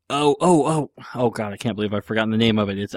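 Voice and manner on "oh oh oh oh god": ordinary talk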